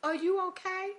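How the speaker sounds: put-on high little-old-lady voice checking in; concerned old lady. Old lady asking crying shopper if she's okay, high-voiced concern.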